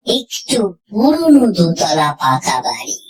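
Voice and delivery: ordinary delivery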